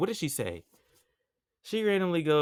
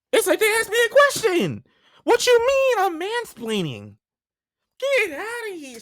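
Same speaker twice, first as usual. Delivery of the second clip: much higher, loud, incredulous; outraged disbelief; 6 dB louder than usual. Retold or imitated. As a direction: squeal it out, utterly offended. Outraged reply to her accusation.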